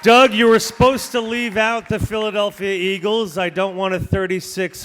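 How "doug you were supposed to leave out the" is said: booming announcer call over speakers; over a PA system. Real, not acted. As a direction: hype the crowd through the mic. Auctioneer booms intro into mic.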